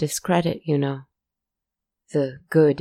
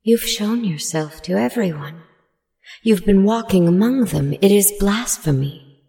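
a gentle being scolding, pitched up; gentle creature; 5 semitones higher, 5 dB louder than usual. A narrator's reading. Gentle creature accusing the narrator, higher voice. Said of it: "The gentle one spoke".

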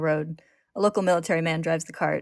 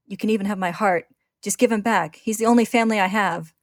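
rising higher and louder in anguish; desperate plea; 4 semitones higher, 5 dB louder than usual. Acted. Ed begging for his brother, anguished. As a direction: give everything for your brother.

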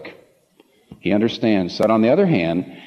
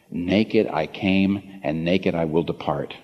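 slow, lower, softer solemn reading; solemn quote; 6 semitones lower, 5 dB quieter, slower than usual. Acted. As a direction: let each word of Job's truth land slowly and softly. Solemnly quoting Job.

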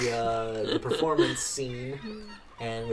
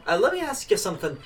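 much higher, louder chirpy little man's voice; tipsy little man; 3 semitones higher, 6 dB louder than usual. Acted. Thrown-out little drunk thanking the dwarf, high and loud. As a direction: play a cheerful drunk runt, pitched way up.

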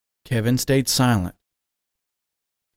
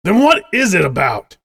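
disappointed demand; 6 semitones higher, 5 dB louder than usual. A narrator's reading. Father glaring, disappointed.

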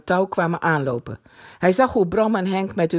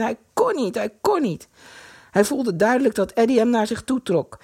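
usual speaking voice

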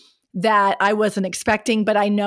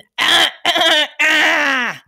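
grandma throat clear; 7 semitones higher, 7 dB louder than usual. Retold or imitated. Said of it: "I sound like my grandma".

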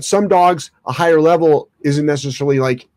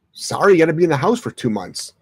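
normal talking voice

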